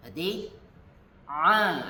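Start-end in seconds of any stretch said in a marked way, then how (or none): 1.3-1.8 "A" with the throat squeezed, pressed hard; pressed ain sound. Shown as a demonstration. Teacher demonstrating a pressed Arabic "A" to students. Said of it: "agak tekan".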